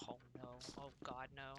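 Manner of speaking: low, quiet "oh no" under breath; quiet dread. Real, not acted. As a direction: murmur it in dismay. Dreading enemies ahead.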